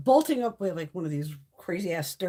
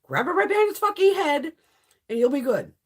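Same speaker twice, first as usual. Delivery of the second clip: loud instruction; 5 semitones higher, 5 dB louder than usual. Retold or imitated. Instructing the men loudly.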